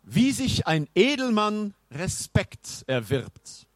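slow, high, haughty nobleman; pompous knight. Acted. Knight speaks of a nobleman's respect, slow and high. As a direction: declaim slowly as an old-time noble. Knight's archaic indignant speech.

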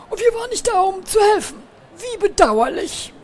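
hoch und theatralisch bedauernd. Acted. (hohe, gezierte Stimme voller Bedauern)

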